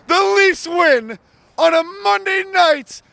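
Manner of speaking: loud, high, drawn-out hype call; big triumphant sports-show opener; excited score announcement. Real, not acted. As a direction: announce the win like an arena announcer, stretching the words. Excited host announces the win.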